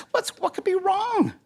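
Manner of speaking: high-pitched voiced concern; concerned animal friends. Friends gather round sighing rooster, high concerned question. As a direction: ask it high, full of concern.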